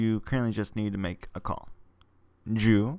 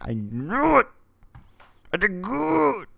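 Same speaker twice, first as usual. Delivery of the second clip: excited cheer; 13 semitones higher, slower than usual. Real, not acted. Reader cheering at a good choice, excited. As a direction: celebrate a little win.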